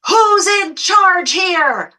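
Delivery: high, loud, drawn-out mock screech; imitating an indignant screech; screeched demand. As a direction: screech it slowly like an outraged customer. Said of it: "and I hear a screech".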